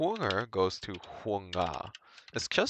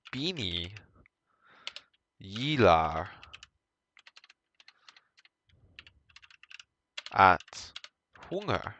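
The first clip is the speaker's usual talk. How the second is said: "Beanie gillar att sjunga" spoken slowly in Swedish; slow Swedish sentence; slower than usual. Shown as a demonstration. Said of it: "Let's do this".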